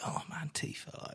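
breathy whisper of the name; hushed, longing whisper; lustful whisper. Retold or imitated. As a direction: sigh the name like a smitten kid. Gamer swooning over a character.

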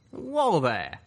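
startled, high and loud protest; blurted. A narrator's reading. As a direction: blurt it out to stop the roaring. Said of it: "Calamity blurted".

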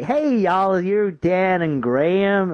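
high, drawn-out fake American host; bad American accent. Escape room host greets the couple, bad American accent. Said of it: "worst American accent I've ever heard".

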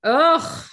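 loud, high "ugh"; exasperated groan. Real, not acted. Fed up with cryptic social media stories, exasperated groan.

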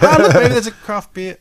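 high, loud feigned surprise; mock surprised discovery. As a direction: exclaim it like an innocent lucky find. Acting out spotting bar to missus.

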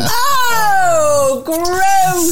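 long, drawn-out revolted cry; disgusted. Real, not acted. Listener reacting to a fart story, disgusted.

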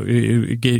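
ordinary talk, nothing marked

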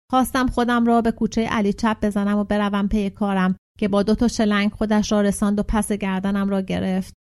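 ordinary delivery, nothing marked

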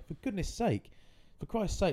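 high, pleading mock reproach; mock exasperated scolding. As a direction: imitate chiding a mate, pitched right up. Retold scolding, correcting own oath.

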